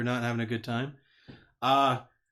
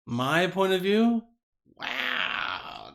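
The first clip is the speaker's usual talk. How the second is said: insinuating; 3 semitones higher, much slower than usual. Real, not acted. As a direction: say it like you heard something scandalous. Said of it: "Why'd you say it like that?".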